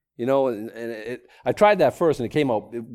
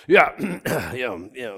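acting out holding back tears, with a throat clear; trying to keep composure; 3 dB louder than usual. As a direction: clear your throat, mimicking someone trying to maintain. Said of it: "I couldn't even maintain. I tried to, like".

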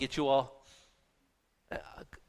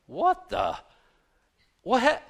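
surprised; 10 semitones higher, slower than usual. Retold or imitated. Reacting to a confusing text.

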